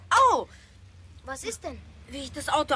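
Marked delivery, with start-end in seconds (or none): 0.1-0.5 sharp, loud yelp of pain; sudden ouch. Acted. Boy pinched by the toy car yelps.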